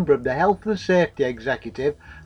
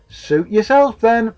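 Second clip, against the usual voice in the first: higher-pitched, offhand comeback; shrugging retort; 7 semitones higher, 4 dB louder, slower than usual. A narrator's reading. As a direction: toss it back with a shrug. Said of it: "retorted Fat Bastard with a shrug".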